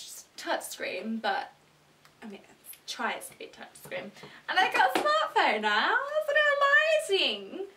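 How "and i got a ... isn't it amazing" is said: very high, loud gushing; gushing excitement. Real, not acted. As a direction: squeal it out, drawing the last word long. Gushing over the new phone.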